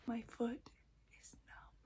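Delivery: very soft whispered aside; whispering. Real, not acted. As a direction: trail down into a barely audible whisper. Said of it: "I'm, like, randomly whispering".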